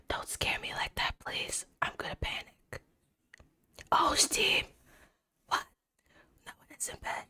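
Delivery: very soft, fully whispered ASMR; soothing streamer whispering into the mic. Shown as a demonstration. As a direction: keep it all to a hushed whisper. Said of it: "I can give you an A S M R".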